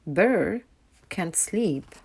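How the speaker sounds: plain reading voice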